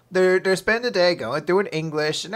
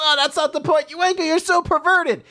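put-on flustered girl; 12 semitones higher than usual. Embarrassed girl scolding the boy, high and flustered.

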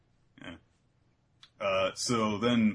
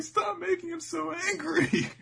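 very high, drawn-out mock plea; hammed-up panicked begging; pleading bystanders; 4 dB louder than usual. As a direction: wail it slowly in falsetto. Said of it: "And everyone's like".

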